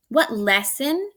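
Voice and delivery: ordinary delivery, nothing marked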